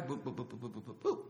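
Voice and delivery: soft, low boop-boop radar noise; imitated radar beeps. Officer's ears perk up like radar, imitated soft beeping. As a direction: mimic a radar pinging, quietly. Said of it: "like radar".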